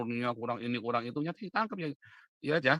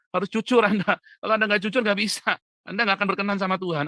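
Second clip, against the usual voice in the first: loud, high-pitched preaching rebuke; forceful admonishing; 8 semitones higher, 8 dB louder than usual. Real, not acted. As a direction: drive it home at full voice. Admonishing listeners to be honest. (menegur keras dan lantang)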